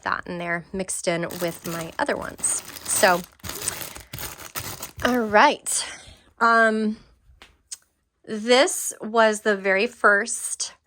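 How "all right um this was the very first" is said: breathless, pausing for air; winded from moving around; out of breath. Real, not acted. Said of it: "I'm out of breath".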